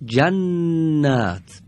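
جنت drawn out very slowly, the doubled ن held; slow demo word. Shown as a demonstration. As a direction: read جنت slowly for the class. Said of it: "همین حرف دارای تشدیده".

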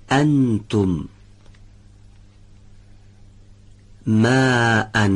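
slow, drawn-out Arabic words, one at a time; teacher's careful Arabic pronunciation for repeating; Arabic recitation demo. Shown as a demonstration. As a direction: model each hamza word with long held vowels.

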